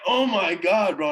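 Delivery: retold mortified cry, loud and high; mortified exclamation. Storyteller reliving his friend's flash going off, mortified. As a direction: exclaim it loudly, reliving the embarrassment.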